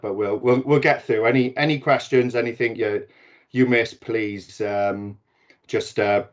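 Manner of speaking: usual speaking voice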